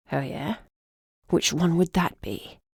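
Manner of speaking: put-on old man voice. Old griffin asks.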